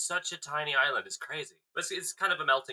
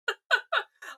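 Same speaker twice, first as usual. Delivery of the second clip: high giggle; 3 dB louder than usual. Real, not acted. Laughs after intro.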